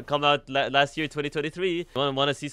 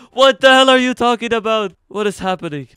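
high, loud disbelief; shocked shout; 9 semitones higher, 9 dB louder than usual. Real, not acted. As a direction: blurt it out shocked. Shocked outburst at chat.

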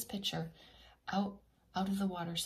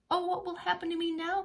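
mild worried cry; 11 semitones higher than usual. Acted. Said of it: "cried Danny".

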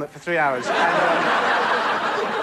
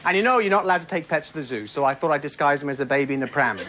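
normal talking voice

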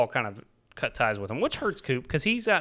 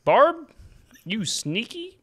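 high-pitched mock accusation, drawn apart; playful scolding; 7 semitones higher, slower than usual. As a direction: tease her as if catching her out. Mock scolding of the sly wife.

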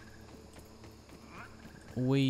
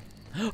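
startled gasp; 9 semitones higher, 4 dB quieter than usual. Real, not acted. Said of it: "You scared me".

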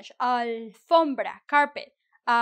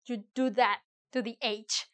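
normal talking voice

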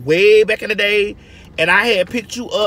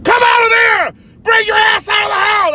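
high, loud shouted order; mock cop yelling through the door; police yelling; 14 semitones higher, 6 dB louder than usual. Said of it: "at the door screaming".

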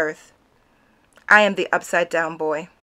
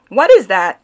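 teacher's sharp question, louder; 8 dB louder than usual. A narrator's reading. Teacher puzzled by the painting, a loud question.

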